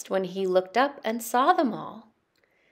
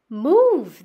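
sharp loud knight's shout; loud urgent shout of alarm; 4 semitones higher, 10 dB louder than usual. Acted. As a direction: bark it to clear the path. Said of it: "they shouted".

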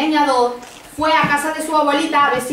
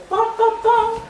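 high sing-song knock sound; imitated door knocking; 9 semitones higher, slower than usual. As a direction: knock with your voice, high and even.

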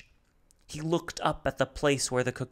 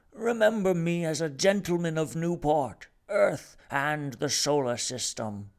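higher, louder proud farewell; Rumford; 6 semitones higher, 4 dB louder than usual. Acted. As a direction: proclaim it grandly.

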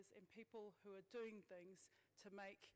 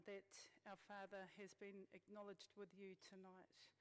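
usual speaking voice

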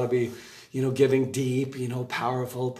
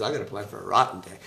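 devil voiced; 5 semitones lower, 4 dB quieter, much faster than usual. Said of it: "the devil says".

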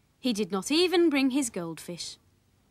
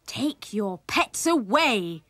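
slow, raised, emphatic order; drawn-out motherly outcry; mother crying out; 5 dB louder, slower than usual. A narrator's reading. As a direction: stretch out the order crossly. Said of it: "cried his mother".

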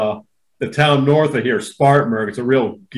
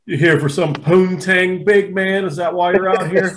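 mock cop tease; 4 semitones higher than usual. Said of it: "imitating cop teasing".

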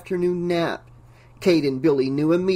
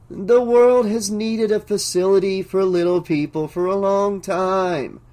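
intoning; 4 semitones higher, 3 dB louder than usual. Acted. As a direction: intone it like a solemn prophecy. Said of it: "Gerald intoned".

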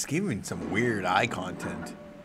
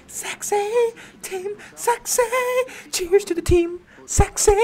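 high squeal cheer; 3 dB louder than usual. Real, not acted. Cheering a chat donation.

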